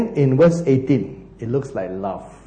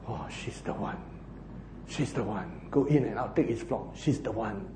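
breathy lovesick murmur, much softer; dreamy whisper; 7 semitones lower, 14 dB quieter than usual. Retold or imitated. Preacher voices lovesick Jacob daydreaming, soft whisper. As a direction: sigh it like a daydream.

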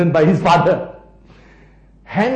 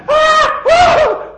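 very high, loud cries; mock terrified scream; 12 dB louder than usual. Jehoshaphat cried out as soldiers came near, mock scream. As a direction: scream out twice, high and theatrical. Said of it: "Jehoshaphat cried out".

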